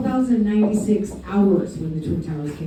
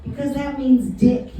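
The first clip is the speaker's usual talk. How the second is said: imitating mom; 6 semitones higher, 3 dB quieter than usual. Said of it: "so my mom would say".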